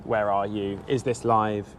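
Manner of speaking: ordinary delivery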